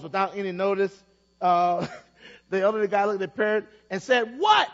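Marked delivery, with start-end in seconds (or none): 4.4-4.7 sudden loud high what; angry shout. A narrator's reading. Old man yelling at the mocking parrot. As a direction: bark it out in irritation.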